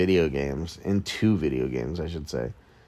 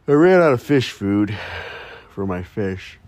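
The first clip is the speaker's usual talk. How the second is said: mock grave announcement; 2 semitones higher, 4 dB louder than usual. Podcaster making a big deal of the fish food, hammed-up. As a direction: announce a trivial disaster.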